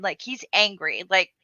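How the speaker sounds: usual speaking voice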